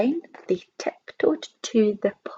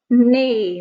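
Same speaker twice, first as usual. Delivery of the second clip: louder imitated horse neigh; whinny it out like a horse; 7 dB louder than usual. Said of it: "let out a deafening sound".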